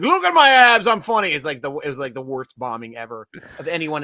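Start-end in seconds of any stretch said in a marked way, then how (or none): 0.0-1.3 high, loud show-off bro voice; cocky gym bro bragging; mock Jersey Shore bro. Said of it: "He's like".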